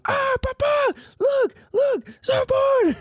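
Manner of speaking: put-on very high, excited little-kid yell; toddler screaming. Dad imitating his son calling to papa, very high excited yell. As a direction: shriek it like a small child pointing at boards. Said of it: "pointing at him and screaming".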